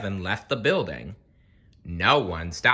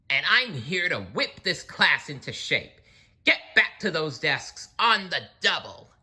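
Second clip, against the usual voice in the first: stern witchy teacher; 8 semitones higher than usual. A narrator's reading. Strict teacher orders class.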